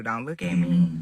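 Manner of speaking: a low take of the defensive line; demo line, low. Shown as a demonstration. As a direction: drop the pitch and say it just as she did. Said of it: "Just like this, do what you do".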